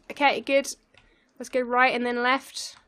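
ordinary delivery

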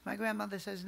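ordinary talk, nothing marked

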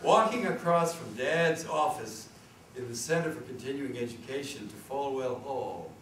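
normal talking voice

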